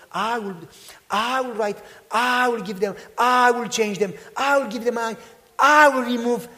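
rising sermon cadence, pressing the point home; building preaching. Real, not acted. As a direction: preach it up until it rings. Preaching builds on God's promises.

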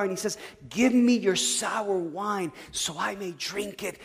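loud, high-pitched sermon climax; impassioned preaching. Real, not acted. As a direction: drive each point home to the congregation at full volume. Preaches at full force.

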